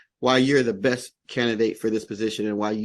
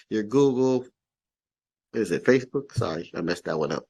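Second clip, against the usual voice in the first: ordinary talk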